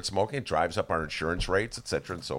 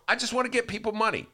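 higher mock Willie Wilson; imitating Willie Wilson; 12 semitones higher, faster than usual. Host recalling Willie Wilson on air, high mock earnest. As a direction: plead it like an eager giver. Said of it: "Remember he used to come on the show".

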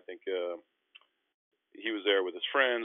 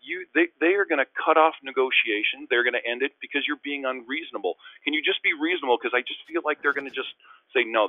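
high, pressing, worried argument; anxious pleading; 6 semitones higher, 5 dB louder than usual. Retold or imitated. Player fears agents will kill negotiations, pleading. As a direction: plead urgently, pitched up throughout.

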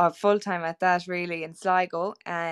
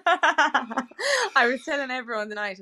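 laughing; 6 semitones higher, 3 dB louder, slower than usual. Real, not acted. Laughing over her own joke.

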